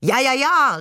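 loud, high exclamation; calling out. A narrator's reading. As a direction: chime in loudly, playing along. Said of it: "rief Shakir".

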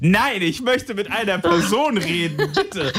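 drawn-out, pleading protest to a bot, breaking into laughter; exasperated plea. Retold or imitated. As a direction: mock-desperate begging, pitch leaping up at the end. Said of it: "ich bin wirklich so".

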